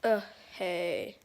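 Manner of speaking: low, soft, bored puppet voice; Dino puppet voice. Shown as a demonstration. Showing the viewer his first puppet, low and bored. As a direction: drag it out low and lazy, like a sarcastic puppet. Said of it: "His voice is like this".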